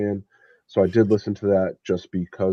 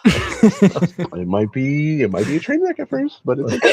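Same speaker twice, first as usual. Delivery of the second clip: laughing through the words, higher; 7 semitones higher, 4 dB louder than usual. Real, not acted. Joking the band might be a train wreck, laughing. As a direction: giggle your way through the joke.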